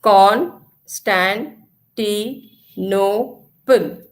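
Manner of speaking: Constantinople split slowly into five syllables, each one apart. Shown as a demonstration. Teacher showing children how to split the word, slow syllables. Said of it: "I repeat".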